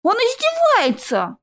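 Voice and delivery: drawn-out, high-pitched wail; anguished howl. Acted. Naev wails about a long stretch of local food, howling. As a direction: wail it in mild outrage. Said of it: "взвыл Наев".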